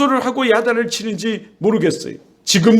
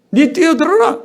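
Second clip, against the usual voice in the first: mock careless parent; 7 semitones higher than usual. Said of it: "이럴까요?".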